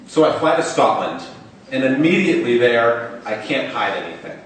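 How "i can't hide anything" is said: ordinary delivery, nothing marked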